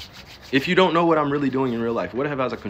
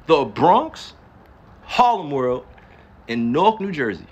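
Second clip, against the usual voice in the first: loud New York and Newark street accent; 4 semitones higher, 6 dB louder, slower than usual. Shown as a demonstration. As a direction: lean into the Bronx and Newark accent. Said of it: "Y'all don't think I talk like this".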